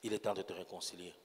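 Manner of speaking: hushed prayer invitation. Acted. Preacher softly invites the standing congregation to reconcile with God. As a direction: draw them quietly into prayer.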